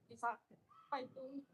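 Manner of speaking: too far from the microphone; distant, off-mic voice. Real, not acted. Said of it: "Deketin mic-nya".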